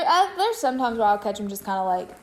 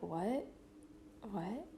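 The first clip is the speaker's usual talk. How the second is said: hushed re-enacted shock; stunned disbelief; 2 semitones lower, 18 dB quieter than usual. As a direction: barely voice it, as if the news won't sink in. Reacting to news of a death.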